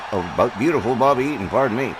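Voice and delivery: ordinary delivery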